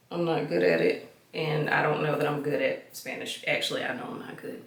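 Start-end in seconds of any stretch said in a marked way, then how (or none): 3.5-4.6 quick whisper under her breath; fast, hushed self-deprecating confession; whispered aside. Real, not acted. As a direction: admit it to yourself in a whisper.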